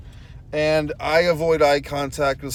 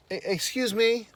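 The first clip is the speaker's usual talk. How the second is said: higher-pitched mimicry of a young stranger; imitating young woman; 7 semitones higher, 4 dB quieter than usual. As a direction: politely get someone's attention in a raised voice. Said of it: "Young woman".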